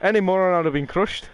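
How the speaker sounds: wobbly, badly faked Irish accent; muddled accent. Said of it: "don't even know what accent I'm doing".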